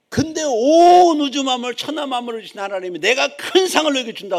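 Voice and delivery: impassioned pulpit cry; full-force preaching. Real, not acted. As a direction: swell with grandeur over God's promise. Preacher proclaims God's promise.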